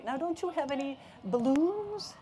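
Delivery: high, stretched, leading question; insistent hinting. Acted. Mom pressing the barber for balloons, insistent. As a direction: push the question up high at the end.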